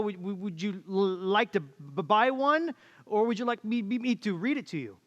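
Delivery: halting stammer, sticking on the first sound of many words; stuttering salesman. Retold or imitated. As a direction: stammer your way through the pitch like a hapless door-to-door salesman. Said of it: "I have the gift of stuttering".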